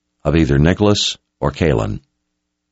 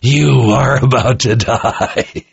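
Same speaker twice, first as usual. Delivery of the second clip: slow, higher taunt breaking into laughter; gloating laugh; 7 semitones higher, slower than usual. A narrator's reading. Dying officer taunts Richard as reinforcements arrive, gloating laugh. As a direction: draw the threat out on a rising belly laugh. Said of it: "A belly laugh rolled up".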